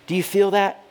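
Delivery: ordinary talk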